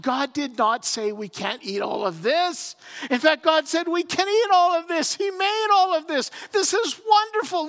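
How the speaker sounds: high, loud gushing delight; gushing enthusiasm. Acted. Eve praising the garden to the snake, high delighted gush. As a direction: gush over every fruit, voice way up. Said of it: "And she says".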